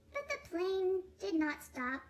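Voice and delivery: ordinary delivery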